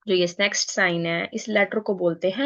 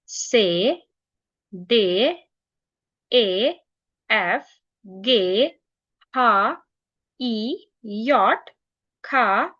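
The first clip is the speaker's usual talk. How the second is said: each letter named alone, evenly spaced; alphabet recital; 2 semitones higher, much slower than usual. Shown as a demonstration. Teacher leads learners through German alphabet, slow and clear. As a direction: recite slowly so learners can repeat. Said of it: "आप मेरे साथ repeat करें".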